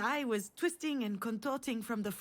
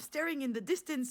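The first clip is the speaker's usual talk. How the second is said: ordinary talk, nothing marked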